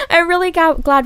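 high, through a real laugh. Real, not acted. Laughing at forgetting closure again, amused. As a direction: start talking before the laugh is over.

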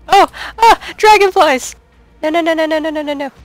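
loud, high panicked cries; panicked screaming. Real, not acted. Chased by dragonflies, screaming.

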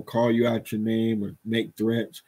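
ordinary delivery

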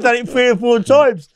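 usual speaking voice

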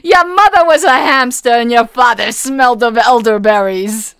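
loud, hammy French insult; mock French accent, shouted up; bad French accent. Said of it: "the guys on top of the castle on Monty Python".

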